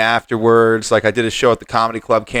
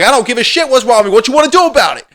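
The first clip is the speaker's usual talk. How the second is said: fast, much higher, louder taunt; retold challenging shout; 12 semitones higher, 6 dB louder, faster than usual. Squaring up to the driver in the street, loud challenge. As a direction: dare him to step up.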